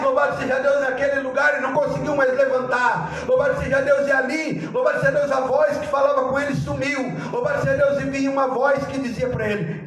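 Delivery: ordinary delivery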